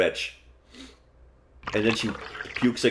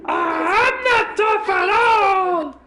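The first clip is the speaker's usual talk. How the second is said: put-on high, slow wailing girl's voice; crying out; 5 dB louder, slower than usual. Naomi sobbing to Seiko that she's not tough, high wail. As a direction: break down in a high sob. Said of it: "Oh, she cries".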